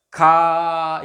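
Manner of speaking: खा held long on a steady आ; long drawn-out syllable. Shown as a demonstration. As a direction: stretch the vowel of खा evenly. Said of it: "जैसे मुझे खा बोलना है". (लंबा खींचकर बोला गया खा)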